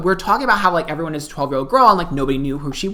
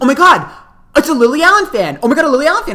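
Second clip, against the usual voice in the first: loud, high-pitched mock squeal of discovery; excited discovery; 9 semitones higher, 6 dB louder, faster than usual. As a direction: imitate a cousin thrilled at spotting someone. Cousin excitedly spots a supposed fan.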